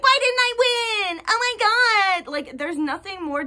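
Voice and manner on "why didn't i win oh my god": high, whining mock client; hammed-up whiny client voice; whiny entitled client. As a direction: mimic a spoiled sore loser wailing. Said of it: "they freaked out and were like".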